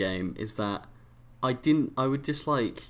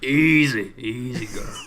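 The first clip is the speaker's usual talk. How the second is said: soothing cowboy calming a horse; Western accent; 6 dB louder, slower than usual. Retold or imitated. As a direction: settle her down like a wrangler. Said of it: "like, a Western accent".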